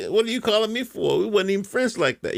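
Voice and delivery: high, indignant quoted reaction; incredulous mock outrage. Vlogger acting out a call from a fired coworker, high indignant disbelief. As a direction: act baffled and put out, pitched high.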